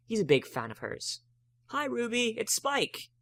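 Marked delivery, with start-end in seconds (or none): 1.7-3.1 Spike voice. A narrator's reading. Spike greeting shopkeeper.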